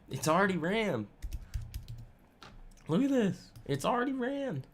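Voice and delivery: playful little high voice; mock high voice. Same line repeated, mockingly higher.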